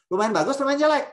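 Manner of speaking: teacher needling the audience about the hedge, teasing; teasing question. Real, not acted. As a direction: poke fun at the vague answer.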